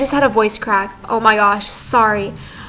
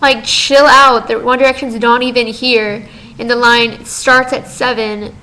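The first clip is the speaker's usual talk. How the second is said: ordinary delivery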